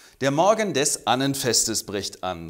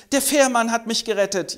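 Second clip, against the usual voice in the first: ex-junkie testimony; 9 semitones higher, 3 dB louder than usual. Acted.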